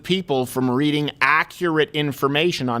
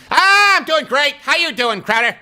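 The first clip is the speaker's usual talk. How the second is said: Mark Levin impression; 10 semitones higher, 4 dB louder than usual. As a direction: boom out a cheery hello like a radio host. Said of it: "That's how he talks".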